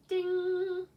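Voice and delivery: high loud sung "ding" bell. Shown as a demonstration. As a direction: ring out like a bell. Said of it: "ding if it rhymes".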